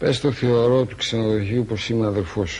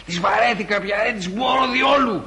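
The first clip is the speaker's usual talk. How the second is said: put-on drunken outburst; 7 semitones higher than usual. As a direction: blurt it out, fed up. Drunk ranting about irritable women.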